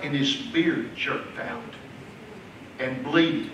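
soft intimate pulpit voice; tender hushed preaching. Acted. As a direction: draw them in close and gently. Tender quiet passage on Jesus' look.

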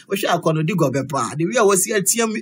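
normal talking voice

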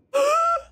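a sharp, high-pitched gasp. Real, not acted. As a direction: gasp like you just spotted them. Gasps at finding them.

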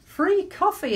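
high, bright sign-reading; poster slogan. Retold or imitated. Preacher reading a shop poster, high and eager. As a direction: read it like an eager advert. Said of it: "it said".

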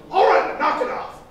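loud, high mock-angry cutoff; exasperated shout. Huge friend interrupts pastor on phone, exasperated shout. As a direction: cut him off, imitating a big man's yell.

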